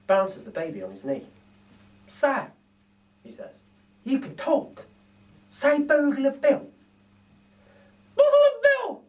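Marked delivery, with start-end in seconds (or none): 2.1-2.5 higher coaxing voice; lightly voiced thief teaching; coaxing burglar. Narrator voices Bill teaching the baby to talk. Bill's voice in read story. 4.0-6.7 higher coaxing voice; lightly voiced thief teaching; coaxing burglar. Narrator voices Bill teaching the baby to talk. 8.2-9.0 put-on very high, louder toddler voice; baby voice. Narrator voices baby repeating a name, squeaky. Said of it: "says the baby".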